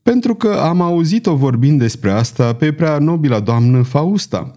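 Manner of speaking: ordinary delivery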